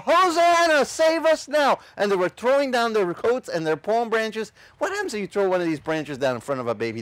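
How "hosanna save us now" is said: high, loud, slow Hosanna cry. Retold or imitated. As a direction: shout it to the crowd. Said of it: "They were crying out".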